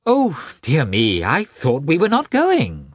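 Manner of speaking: high-pitched vexed wife. Acted. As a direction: protest in exasperation, pitch jumping high. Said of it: "said his wife with vexation".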